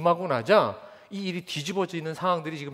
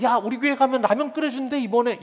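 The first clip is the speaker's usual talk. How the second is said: put-on high, loud eager kid pitch; excited kid; 7 semitones higher, 5 dB louder than usual. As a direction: lure a friend with ramen, excited. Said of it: "이렇게 전도할까요?".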